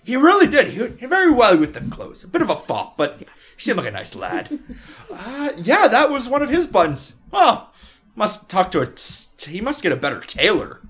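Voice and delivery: loud, hearty dwarf rambling; jolly dwarf. Acted. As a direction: play the cheery old dwarf chatting. Dwarf gossiping.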